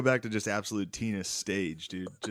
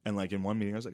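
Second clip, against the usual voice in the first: ordinary talk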